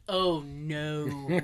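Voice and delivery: low, sinking oh no; mock dismay. Viewer spotting Kevin Bacon on screen, mock dismay. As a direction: groan it low in comic dread. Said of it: "I went".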